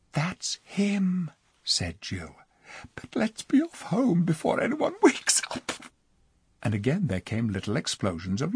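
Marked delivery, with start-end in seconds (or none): 0.1-5.7 put-on higher whispered girl's voice. Jill to the others near the enemy camp, excited whisper. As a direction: whisper it, bursting with secret fun.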